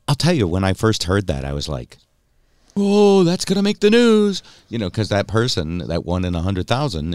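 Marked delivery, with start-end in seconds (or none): 2.8-4.4 high, loud exclamation; retold gasp of news-worthy shock; mock alarmed reaction. Mock reaction on first hearing it.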